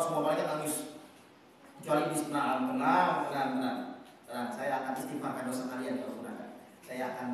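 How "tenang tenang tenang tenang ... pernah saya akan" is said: low, soft, soothing voice; calm everyone down, quietly sure of yourself; smug reassurance. Acted. Iblis reassuring the crying angels, low and soothing.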